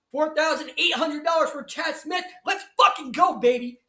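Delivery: high, building to a loud cheer; celebratory shout. Real, not acted. Commentator celebrating a viewer's winning bet, shouting excitedly. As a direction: cheer the big win at full voice.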